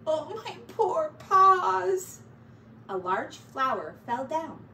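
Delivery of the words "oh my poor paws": high, loud, slow whimper; whiny wail. Acted. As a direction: moan over sore paws. Pack Rat moaning.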